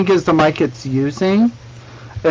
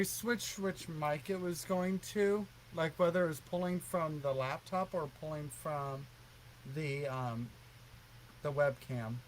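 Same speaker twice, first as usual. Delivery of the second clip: far from mic; 22 dB quieter than usual. Real, not acted. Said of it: "you sound like you've gone to another room".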